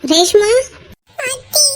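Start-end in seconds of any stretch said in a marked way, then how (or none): 1.3-1.7 soft, tearful apology; small, crying voice; tearful confession. Acted. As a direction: confess quietly through tears.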